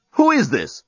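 high indignant outburst. A narrator's reading. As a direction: break in with mock outrage. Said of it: "switched back to indignation mode".

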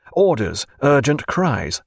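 usual speaking voice

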